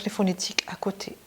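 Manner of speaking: whispered aside to the class. Real, not acted. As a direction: whisper the tip. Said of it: "teacher aside".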